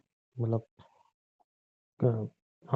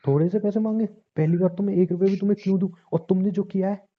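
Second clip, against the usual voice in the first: husband snapping back at his wife, indignant and raised; angry outburst; 8 semitones higher than usual. Acted. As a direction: fire it back at her, outraged. Husband flares up in quarrel.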